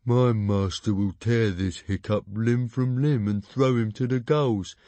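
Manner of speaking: slow, boastful dragon threat; nasty dragon henchman voice; hissing dragon. A narrator's reading. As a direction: threaten slowly on your master's behalf. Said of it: "hissed Dog's Breath Dragon's sea slug".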